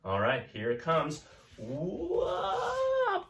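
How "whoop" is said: a high, sliding whoop of fun; playful whoop. Acted. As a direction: whoop it up for the kids. Playful whoop opening the story.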